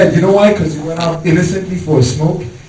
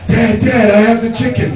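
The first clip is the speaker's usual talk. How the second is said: put-on excited kid; 6 semitones higher than usual. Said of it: "I'm like".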